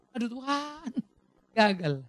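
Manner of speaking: normal talking voice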